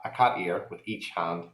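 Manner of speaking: ordinary delivery, nothing marked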